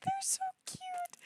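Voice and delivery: very high, soft squeak; tiny, soft, high-pitched coo; tiny squeal. Real, not acted. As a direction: squeak it out, overcome by cuteness. Overwhelmed by cute items.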